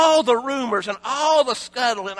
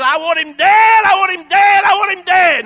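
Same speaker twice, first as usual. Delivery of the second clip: shouted, higher and louder, repeated; furious demand; 7 semitones higher, 9 dB louder than usual. Retold or imitated. Herodias demanding John's death, a furious shout. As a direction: hammer it three times in fury. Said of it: "And she says".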